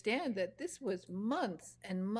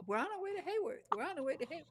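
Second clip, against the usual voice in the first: high sing-song imitation of herself; mock naive tourist; 9 semitones higher, much faster than usual. Imitating herself announcing the trip to the Bronte village, mock naive tourist. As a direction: chirp it high, sending up your own mistake. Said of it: "And I'm saying Hayworth".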